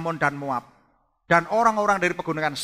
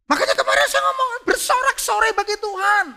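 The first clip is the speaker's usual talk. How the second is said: high, loud, fast exhortation; rally the congregation with a shout; fiery preaching call; 4 dB louder than usual. Real, not acted.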